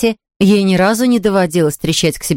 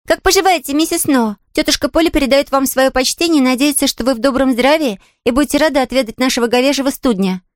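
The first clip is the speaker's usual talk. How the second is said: put-on high, eager child's voice; polite, cheerful girl; 7 semitones higher than usual. Girl bringing her aunt's greetings to the invalid, polite. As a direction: deliver a courteous message as a little girl.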